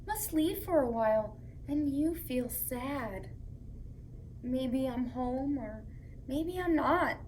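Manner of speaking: gentle storytime read-aloud; reading to children. Acted. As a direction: read it like a bedtime book to little kids. Reads picture book to children.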